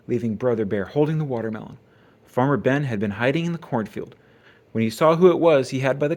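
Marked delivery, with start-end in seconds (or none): none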